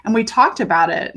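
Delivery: ordinary talk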